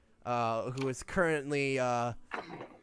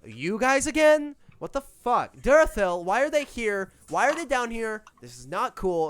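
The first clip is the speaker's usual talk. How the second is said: higher, louder petulant queen; annoyed queen; 8 semitones higher, 8 dB louder than usual. Acted. Queen spots the party downstairs, higher and louder petulant whine. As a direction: whine it like a spoiled queen who's been crossed. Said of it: "She looks at you".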